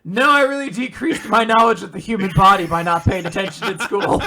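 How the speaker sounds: hammed-up admission on the edge of laughing; mock earnest protest. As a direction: play at owning up, loud and fast. Acted protest from the character.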